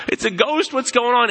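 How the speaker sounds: high, alarmed voice of frightened disciples; mock panicked cry. Disciples in the boat see a figure on water, panicked. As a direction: gasp it out like you've seen a ghost. Disciples freaking out, imagined quote.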